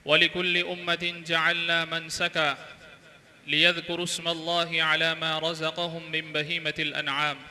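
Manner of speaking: slow, measured Arabic recitation with drawn-out vowels; Arabic sermon recitation. Real, not acted. Preacher opening his sermon with Quran and hadith, solemn recitation. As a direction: recite the opening verse and hadith slowly and evenly.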